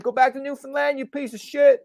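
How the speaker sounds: high-pitched mock-angry heckle; heckling New Yorker yell. New Yorker telling off a Newfoundlander, mock angry yell. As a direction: hurl it like an angry local. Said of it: "the New Yorker will yell out".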